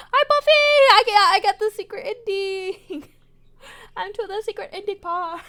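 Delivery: high, loud squeal of delight; excited. Real, not acted. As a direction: greet Buffy bursting with joy. Player excited reaching secret ending.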